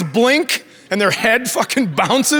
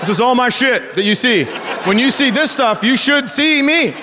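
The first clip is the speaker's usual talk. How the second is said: higher, mock-menacing warning to the boyfriend; mock stern dad lecture; 6 semitones higher than usual. Dad warning daughter's boyfriend, mock-menacing. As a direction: lay down the law with a grin.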